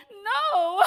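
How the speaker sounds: loud, high tearful wail, hammed-up; mock wailing. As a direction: bawl it out like an inconsolable friend. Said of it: "she'd be like".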